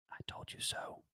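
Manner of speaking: creepy breathy whisper into the mic; creepy Joe Biden whisper. Pretend. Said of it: "creepy Joe Biden whisper".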